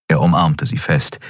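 plain reading voice

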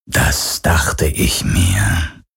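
low, very slow ghostly voice; menacing phantom. A narrator's reading. The phantom mocks Garland's bluff, low and slow. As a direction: let it drip with dark amusement.

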